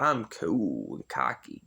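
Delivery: lightly voiced cocky cool guy, slow and low; a hint of a smug tough guy; slow, low mock swagger. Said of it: "this cool guy".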